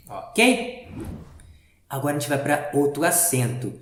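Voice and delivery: normal talking voice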